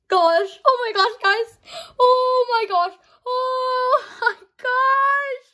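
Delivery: high, loud gushing oh my gosh, stretched out at the end; overjoyed, near tears. Real, not acted. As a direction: gush over the card, almost crying. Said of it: "I'm legit about to cry".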